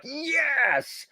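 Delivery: high, drawn-out triumphant yes; celebrating a great rating; mock elation. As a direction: cheer it out long and high. Acting out overjoyed guildmate's reaction.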